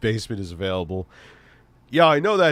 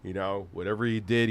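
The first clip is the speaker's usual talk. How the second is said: ordinary talk, nothing marked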